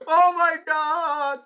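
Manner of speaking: very high, drawn-out loud squeal; long high-pitched outcry; excited shout. Real, not acted. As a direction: yell it slowly, stretched right out. Exclaiming over the kiss moment.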